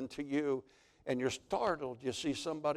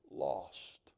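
much softer and lower, the cry dying away; fading scream; 7 semitones lower, 7 dB quieter than usual. Acted. Lost soul's scream fading below the waterfall, hushed and low. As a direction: let the last cry fall away into the distance.

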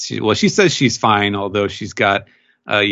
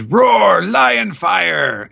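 high, loud battle shout; Hold'em calling his lion attack, hammed-up shout; 10 semitones higher, 3 dB louder, much slower than usual. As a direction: bellow the attack name.

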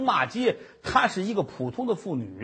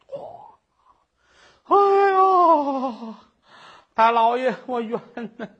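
high, loud keening cry; 哭喊冤枉; wailing plea; 4 semitones higher, 4 dB louder, much slower than usual. Acted. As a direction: wail to the judge after coming to. Woman wakes crying injustice.

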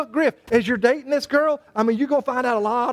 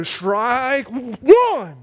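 drawn-out umpire call. Shown as a demonstration. The pastor mimicking a crazy umpire: drawn-out strike call. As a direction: stretch it out like a showy umpire. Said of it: "they'd wait and they go".

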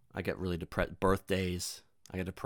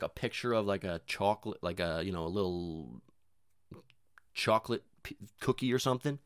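usual speaking voice

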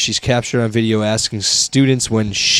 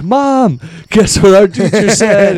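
mock excited kid; 9 semitones higher, 4 dB louder, slower than usual. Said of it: "went home and were like".

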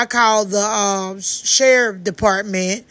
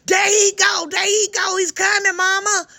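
imitated high panicked shouting; much higher, urgent calls to warn her mother; screaming; 8 semitones higher than usual. Daughter in the truck spotting the attacker, retold high frantic shout. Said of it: "My daughter was screaming up to the top of her lungs".

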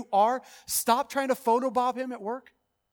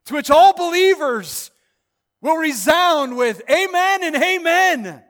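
loud, high, slow resounding cry; ringing preacher's shout; preaching at full force; 6 semitones higher, 10 dB louder than usual. Real, not acted. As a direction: lift it to a shout of triumph. Climax of sermon, shouting.

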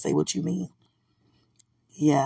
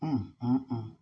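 normal talking voice

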